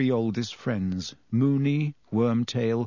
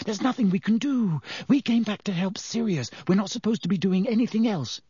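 higher, urgent schoolgirl insisting; anxious Hermione; 8 semitones higher than usual. A narrator's reading. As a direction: keep him from interfering, high and tense.

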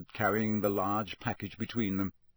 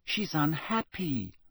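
exasperated; 6 semitones higher, slower than usual. A narrator's reading.

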